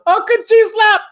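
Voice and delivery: very high, loud mock yell. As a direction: ham up his outraged yell. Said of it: "he just yells".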